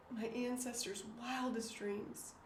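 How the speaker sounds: repeating the billboard line to herself, hushed in awe; awed and soft. Real, not acted. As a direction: let the words hang, moved. Savours the billboard words.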